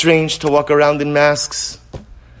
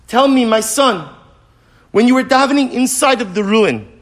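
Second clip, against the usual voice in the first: raised, rising preacher's voice, higher; impassioned storytelling; 8 semitones higher, 3 dB louder than usual. Acted. Rabbi telling students the Gemara story, rising fervor. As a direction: build the story with growing fervor.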